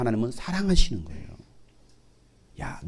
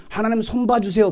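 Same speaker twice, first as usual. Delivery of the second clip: higher, pleading voice; mock prayer; 8 semitones higher than usual. Preacher imitating a vengeful prayer, higher mock pleading. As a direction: plead to God like a petty believer. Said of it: "기도는 하지 마세요".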